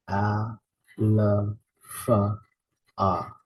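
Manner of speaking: "A, L, F, A" as separate sounds: ah, luh, fuh, ah; sounding out. Shown as a demonstration. Teacher sounding out alpha, one letter sound at a time. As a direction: sound out "A, L, F, A" with gaps between. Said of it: "Let's go ahead and sound it out".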